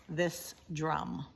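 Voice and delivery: plain reading voice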